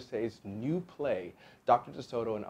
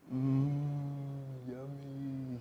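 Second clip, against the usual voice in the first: dreamy mumble; 4 semitones higher, much slower than usual. A narrator's reading. Fox dreaming under gas, dreamy mumble. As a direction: hum and murmur as if tasting in sleep. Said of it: "he mumbled".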